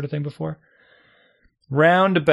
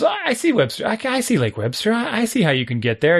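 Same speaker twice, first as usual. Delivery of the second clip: excited woman; 9 semitones higher than usual. Retold or imitated. Woman on horse voiced excitedly.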